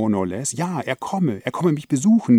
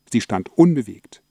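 usual speaking voice

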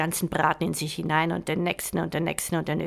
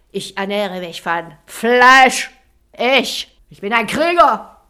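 brutish warlord; 8 dB louder than usual. Acted. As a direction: sneer it like a proud brute. Voices the warlord.

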